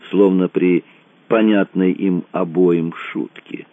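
ordinary delivery

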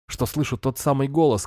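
ordinary delivery

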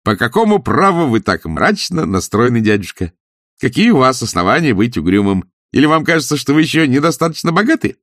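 cheerful retort. A narrator's reading. Nephew turning his uncle's questions round, cheerful. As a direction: toss the questions back merrily. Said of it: "весело отозвался племянник".

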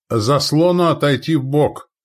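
loud, high military command; barked order. A narrator's reading. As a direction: bark it out to the troops. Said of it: "рявкнул старший орудийной дружины".

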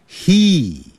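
"He" drawn out with a long "ee"; high, held "ee" on each "he"; long vowel. Shown as a demonstration. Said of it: "He是发长的元音".

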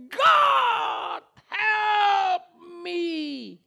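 very high, loud, drawn-out sobbing cry; crying shout. Retold or imitated. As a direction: wail it out slowly as a desperate plea. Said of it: "Maka dia berteriak". (teriakan menangis yang panjang)

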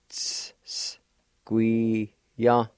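Ts, s said as hissed unvoiced s with a pause; drawn-out s like a snake. Shown as a demonstration. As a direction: hiss the s before squee. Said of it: "just a little hesitation in between".

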